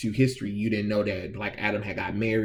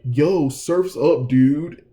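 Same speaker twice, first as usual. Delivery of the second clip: slow, loud, higher laid-back surfer dude; surfer bro; 4 semitones higher, 7 dB louder, much slower than usual. Retold or imitated. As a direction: stretch it out like a beach bro. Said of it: "felt like some surfer bro".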